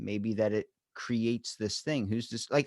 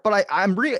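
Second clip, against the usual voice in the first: desperate protest; 9 semitones higher, 8 dB louder than usual. Retold or imitated. Ghost protests before vanishing.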